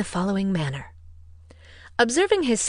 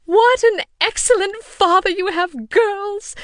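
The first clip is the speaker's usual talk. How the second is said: much higher, louder, slow gushing mother voice; 13 semitones higher, 8 dB louder, slower than usual. A narrator's reading. Mother praising father to the girls, high and gushing. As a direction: rhapsodize about their father.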